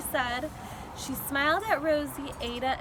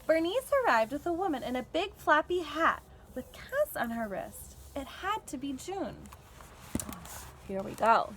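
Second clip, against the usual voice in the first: plain reading voice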